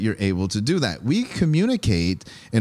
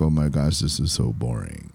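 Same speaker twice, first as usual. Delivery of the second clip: low, flat-toned drone, far lower; monotone; 7 semitones lower than usual. Shown as a demonstration. Host mimics a bored monotone talker, low and dull. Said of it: "they just talk in one low monotone".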